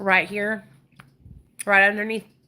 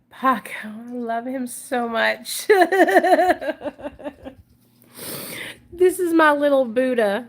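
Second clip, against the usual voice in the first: overwhelmed by cuteness; 3 semitones higher, 6 dB louder than usual. Real, not acted. Gushing over her dogs.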